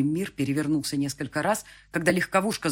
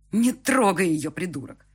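louder, slow angry warning; 4 semitones higher, 7 dB louder, slower than usual. A narrator's reading. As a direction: snap at him to stop. Внезапно влез Андрей. (сердитое предупреждение)

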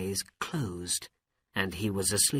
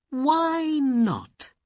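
princess voice; 4 dB louder than usual. Acted. Princess feigns agreement.

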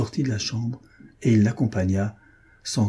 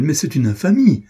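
loud, high, appalled exclamation; scandalized outburst, voice leaping up; outraged cry; 7 semitones higher, 5 dB louder than usual. A narrator's reading. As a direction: cry out in shocked indignation. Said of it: "s'écria-t-elle".

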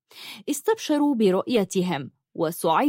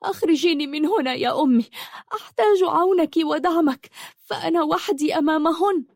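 much higher, fast, eager plea; eager pleading; 8 semitones higher than usual. Acted. Trapped daughter begging her mother for help, eager and pleading. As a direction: beg urgently to be rescued. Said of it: "قالت بتلهف".

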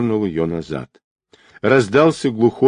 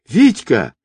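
high-pitched desperate call; desperate woman's cry; 7 semitones higher, 3 dB louder than usual. A narrator's reading. Girl sees her man swept toward rocks, desperate high cry. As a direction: cry out in alarm for a loved one. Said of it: "раздался отчаянный женский крик".

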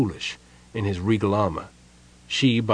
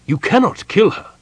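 raised, higher and louder refusal; firm and loud; 11 semitones higher, 6 dB louder than usual. A narrator's reading. King forbidding the general, loud and firm. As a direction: put your foot down hard. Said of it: "Sylvan said firmly".

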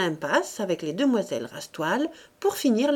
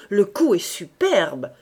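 admiring exclamation; 7 dB louder, slower than usual. A narrator's reading. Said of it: "répéta monsieur Rastoil".

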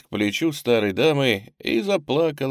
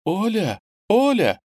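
high, louder urgent calling; agitated call; 10 semitones higher, 4 dB louder, slower than usual. A narrator's reading. Headmistress calling the girl from next room, agitated. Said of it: "позвала взволнованным тоном".

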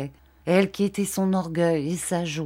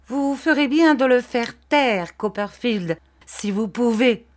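urgent, higher-pitched cry of warning; 5 semitones higher, 3 dB louder than usual. A narrator's reading. Uriah urging Copperfield to silence Wickfield, crying out. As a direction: raise the pitch and push the first words out louder. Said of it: "s'écria Uriah".

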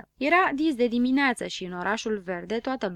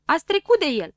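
loud, high call; 8 semitones higher, 8 dB louder than usual. Acted. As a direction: shout it after the ones who walked past. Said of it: "începu să strige prichinduța". (strigă tare și sus)

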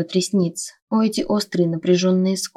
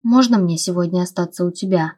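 usual speaking voice

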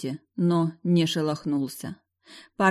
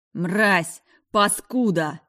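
yelling, voice breaking; 3 semitones higher, 5 dB louder, much slower than usual. Acted. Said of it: "заорал Джейс сорвавшимся голосом".

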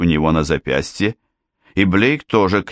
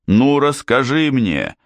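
a higher, challenging yell; mild yell; 6 semitones higher than usual. A narrator's reading. Blake yelling back at his armed brother, high and challenging. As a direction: snap it back at him, pitched up. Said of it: "заорал Блейк".

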